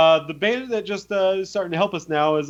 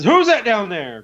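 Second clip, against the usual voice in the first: shouted call from above; 6 semitones higher, 5 dB louder than usual. Retold or imitated. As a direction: call down the stairs, alarmed. Owner calling from upstairs.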